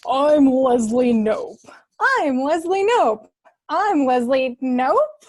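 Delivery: slow, loud announcer voice; radio announcer voice. Acted. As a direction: boom it out like a DJ. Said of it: "Just talk like you normally do".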